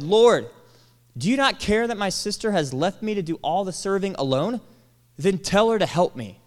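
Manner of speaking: loud, high indignant demand; demanding Martha. Retold or imitated. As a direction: read it as an indignant order. Reading Martha's demand dramatically.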